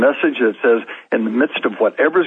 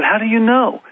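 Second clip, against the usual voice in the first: high-pitched retold challenge; disbelieving question; 11 semitones higher than usual. Retold challenge to her claim.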